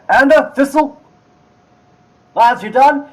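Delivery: loud, high shout to the lads; calling out. Acted. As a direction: holler through to your helpers. Shopkeeper calls to his helpers.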